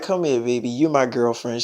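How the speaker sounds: soothing. Retold or imitated. Soothing jealous girlfriend.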